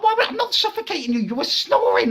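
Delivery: high, fast, defensive babble; squeaky retold argument; flustered protest. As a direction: protest your innocence in a comic panic. Retold panicked defence to girlfriend.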